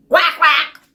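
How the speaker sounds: loud quacking duck. Acted. Dog quacks in story.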